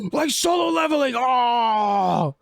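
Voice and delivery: gasping, shooting high with sudden glee; excited shout. Real, not acted. Gamer catches an anime reference and yelps excitedly. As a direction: blurt it out, thrilled by the reference.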